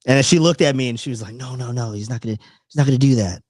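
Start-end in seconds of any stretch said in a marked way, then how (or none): 1.3-3.4 imitating girlfriend. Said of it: "Exactly what she sounded like".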